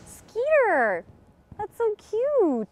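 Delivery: high squealing delight; gushing. Acted. Gushing over shy fan.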